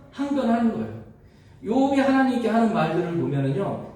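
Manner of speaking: ordinary talk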